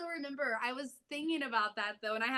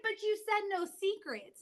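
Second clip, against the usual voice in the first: daughter's protest; 8 semitones higher than usual. Retold or imitated.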